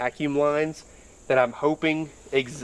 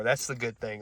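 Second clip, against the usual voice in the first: ordinary talk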